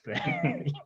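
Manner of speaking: sudden high self-mocking laugh; a quick squeak of a laugh at himself; real amused laugh. Real, not acted. Laughs at own analysing.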